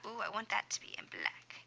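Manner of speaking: whispered aside to herself; a quick self-directed whisper. Real, not acted. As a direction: mutter it under the breath while busy. Aside to herself while drawing.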